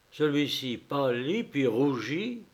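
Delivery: usual speaking voice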